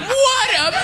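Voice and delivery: very high, slow gushing shout; high admiring crowd cry. Retold or imitated. Onlookers admiring the macho man, a high slow gush. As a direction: swoon over the macho guy. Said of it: "Everyone's around him".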